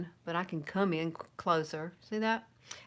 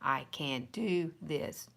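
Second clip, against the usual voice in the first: very slow, deliberate affirmation; slow self pep talk; 4 semitones lower, 4 dB quieter, much slower than usual. Shown as a demonstration. Coaching viewers to believe in themselves, slow affirmation. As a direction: draw out each word, lower and resolute. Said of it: "Tell yourself".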